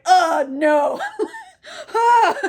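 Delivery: loud shouted oh no; mock shout. [name] reacting to the gruesome shark kill, loud mock shout. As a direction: yell it out in mock horror. [name] watches head eaten.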